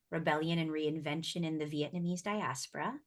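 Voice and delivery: ordinary delivery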